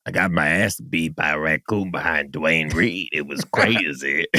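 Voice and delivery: deadpan a ridiculous tale down low; mock tough storyteller. Joking mock story of being tough.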